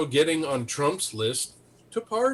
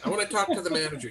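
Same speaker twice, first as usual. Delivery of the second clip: a Karen demanding the manager, higher and quick; whiny entitled customer, fast; entitled woman voice; 3 semitones higher, faster than usual. Retold or imitated. Mocking a witness at the hearing as a Karen demanding the manager. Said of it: "Oh, this one gal".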